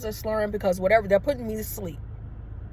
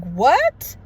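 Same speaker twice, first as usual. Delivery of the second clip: retold shock, louder and higher; shocked reaction; 4 semitones higher, 6 dB louder than usual. Patient told to call next of kin, retold shocked outburst. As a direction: blurt it out in disbelief.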